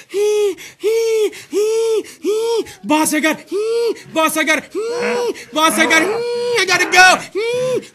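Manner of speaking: high, loud hammed-up wheeze between words; mock wheezing. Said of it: "I'm getting out of breath".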